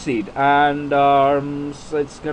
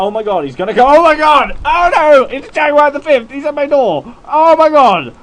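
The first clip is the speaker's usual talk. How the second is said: high, very loud panicked yelling; mock panic; 12 dB louder than usual. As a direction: scream as if the hunter is at your door, hammed up. Pretends the hunter is at door.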